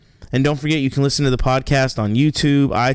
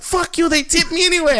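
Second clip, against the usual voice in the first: angry yell. Retold or imitated. Cab driver yelling out window.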